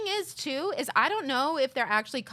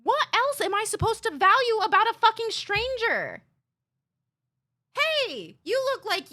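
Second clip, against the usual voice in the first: high, louder mock voice of a rude commenter; high scornful mocking voice; 9 semitones higher, 4 dB louder than usual. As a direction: sneer it up high like a jerk.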